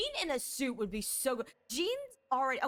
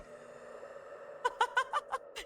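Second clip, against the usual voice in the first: giggle; 13 semitones higher, 3 dB quieter than usual. Real, not acted. Laughs at own bit.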